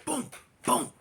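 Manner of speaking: mouthed thuds; crash sounds. Retold or imitated. Narrator mimicking the car being rammed, mouthed booms. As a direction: imitate the bump.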